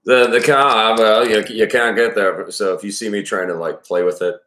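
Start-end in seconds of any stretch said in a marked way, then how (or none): 0.1-2.5 slow, drawn-out Maine accent; Mainer accent. Shown as a demonstration. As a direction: play with a broad Mainer accent. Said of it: "uses a Mainer accent".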